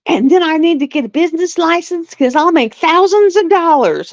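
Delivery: a hint of the excited friend dreaming big; eager friend. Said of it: "she's like".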